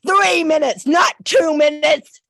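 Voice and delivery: loud, high furious protest; angry shout. Real, not acted. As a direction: shout it at the council in outrage. Angry caller protests time limit.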